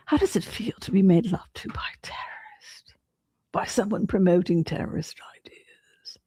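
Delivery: hushed, fast, teasing whisper; breathy gossip whisper, quick; mock whisper. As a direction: whisper it like a juicy secret. Mock whispered question about the scene.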